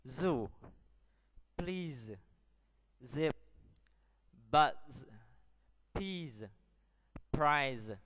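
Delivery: isolated words with a voiced buzzing Z in "Zoo", "Buzz", "Prize"; word-list demo, hum the Z in "Please", "Peas"; modelling the Z sound. Shown as a demonstration. Teacher models the second column for pupils, voiced Z in "Zoo, Zip, Buzz". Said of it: "Now listen to me".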